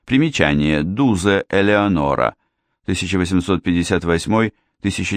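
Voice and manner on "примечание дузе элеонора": ordinary delivery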